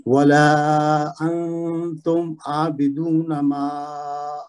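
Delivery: slow measured tajweed recitation; Quran recitation. Shown as a demonstration. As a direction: recite the verses slowly with tajweed. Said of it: "یہ پہلی اب بس آپ پڑھ دیجیے". (آہستہ تجوید کے ساتھ تلاوت)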